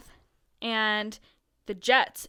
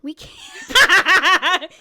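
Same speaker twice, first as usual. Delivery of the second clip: laughing through words; giggling, higher and louder; 6 semitones higher, 10 dB louder than usual. Real, not acted. Both laughing at their ignorance.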